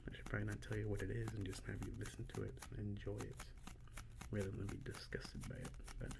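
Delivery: soft unvoiced whisper; ASMR whisper. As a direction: breathe the words close to the mic.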